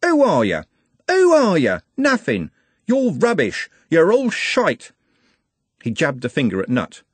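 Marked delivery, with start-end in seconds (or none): 0.0-4.9 high, loud, scornful denunciation; screaming rage. A narrator's reading. Trev rounding on the others, screaming rage. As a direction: spit each insult out separately. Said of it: "screamed Trev".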